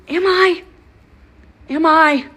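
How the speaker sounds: high, loud mocking "am I?"; sarcastic disbelief. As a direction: throw his words back at him. Sarcastic reply to "old lady.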